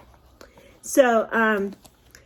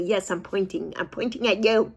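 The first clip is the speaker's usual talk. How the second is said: usual speaking voice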